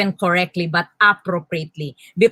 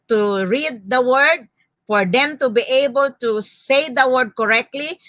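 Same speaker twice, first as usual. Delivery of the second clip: ordinary talk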